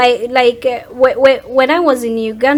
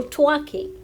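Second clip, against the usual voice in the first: ordinary talk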